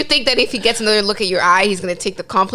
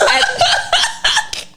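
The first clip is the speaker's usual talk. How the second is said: laughing. Real, not acted. Laughing while asking.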